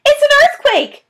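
very high, loud alarmed shriek; panicked cry. Acted. Missus Fox crying out in panic to her family. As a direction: scream out the danger. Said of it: "cried Missus Fox".

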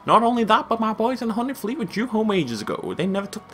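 high-pitched, badly faked southern woman; bad southern accent. Fishery owner frets over her overdue boys, high fake southern accent. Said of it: "I don't know why she's southern".

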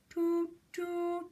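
high train whistle imitation; two even, high toots, the second held longer. Shown as a demonstration. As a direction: toot like a little train. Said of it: "Nice train sound".

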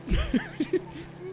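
high-pitched laughter near tears; high laughing fit. Real, not acted. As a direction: laugh until it cracks. Laughing at mockery.

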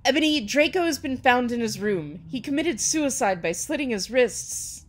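hammed-up grave announcement. A narrator's reading. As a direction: announce the tragedy with mock gravity. Grave news announced by story figure.

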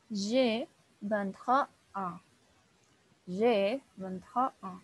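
"J'ai vingt-trois ans" drawn out very slowly, nasal vowels; very slow French model phrase. Shown as a demonstration. Teacher drills age phrase slowly for students. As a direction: spell it out with your voice, syllable by syllable. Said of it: "en cómo lo voy a pronunciar yo".

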